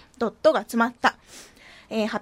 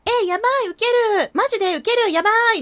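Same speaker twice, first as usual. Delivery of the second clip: put-on much higher, louder excited girl talk; gushing girly voice; 9 semitones higher, 6 dB louder than usual. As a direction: squeal like a giddy teenage girl. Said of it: "女子的な感じの喋りも".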